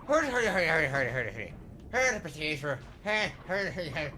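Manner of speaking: loud, high, hammed-up Irish accent; mock Irish accent. Streamer mocks Irish chat with a hammed-up Irish accent. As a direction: rattle it off like a stage Irishman. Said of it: "that's my impersonation of what an Irishman is".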